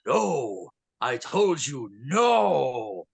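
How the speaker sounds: slow, drawn-out refusal; acted-out forbidding shopkeeper; stern shopkeeper. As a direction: stretch the words out like a warning. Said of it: "So he walks in saying".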